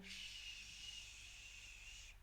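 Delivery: long, drawn-out "shhh"; imitated hissing noise. Shown as a demonstration. Teacher showing learners the sound of running water, imitated hiss. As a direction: sustain a soft voiceless water hiss. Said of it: "tipo aquele som".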